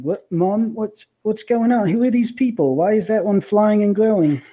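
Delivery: put-on high, sleepy confused kid; a kid just woken up, bewildered; groggy child voice. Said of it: "you hear a groggy voice, like, waking up".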